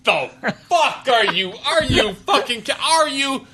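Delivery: high-pitched incredulous outburst; Cable. Acted. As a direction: sputter it high and half-laughing. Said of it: "And Cable's like".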